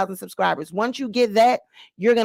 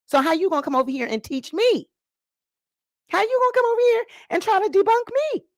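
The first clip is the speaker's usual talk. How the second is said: incredulous; 10 semitones higher, faster than usual. Real, not acted. Calling out a commenter.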